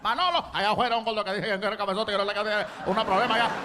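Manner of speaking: rapid-fire Spanish grumbling, imitated waiter; talking trash. Waiter complains to cook about customer, fast trash talk. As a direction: rattle off a fast mock complaint. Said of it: "he starts talking trash".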